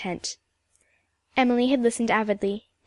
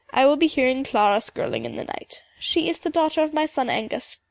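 put-on old Scottish storyteller with a lilting accent; old Highland woman. Mistress McIntyre speaks of Clara.

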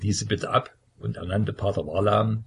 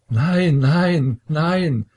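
loud, higher, terrified denials; horrified cry; 7 semitones higher, 7 dB louder, much slower than usual. A narrator's reading. Son denies plotting his father's death, loud terrified cry. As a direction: cry it out in horror. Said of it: "rief der Zarewitsch".

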